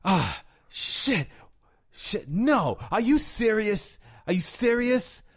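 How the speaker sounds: high, loud, disbelieving outbursts with long gaps; hammed-up man suffering in a toilet stall; strained, drawn-out cries of dismay; imitated bathroom anguish. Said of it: "this guy's just like".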